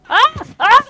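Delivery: sudden high piercing scream; two sharp startled cries. Real, not acted. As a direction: shriek in real alarm. Startled by attack in game.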